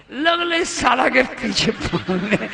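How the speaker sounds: slow, laughing put-on voice of the husband; mock the husband's jibe with a laugh; husband's mocking retort. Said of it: "کیا کہتا بیوی کو".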